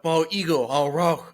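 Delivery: slow, choppy broken English echo. Retold or imitated. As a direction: parrot it back in broken English. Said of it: "saying everything I was saying, but in broken English".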